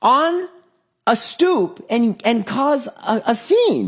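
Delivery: high, slow exasperated dad voice; mock scolding dad. Dad scolding his son over the stoop scene, high and slow. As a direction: drag it out like a fed-up parent.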